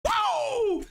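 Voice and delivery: very high celebratory woo; long falling whoop of joy; jubilant whoop. Real, not acted. Commentator celebrates Middlesbrough going ahead, jubilant whoop.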